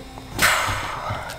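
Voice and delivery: soft, drawn-out exhale; emotional sigh. Real, not acted. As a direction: breathe out the heavy feeling. Emotional after the sad part.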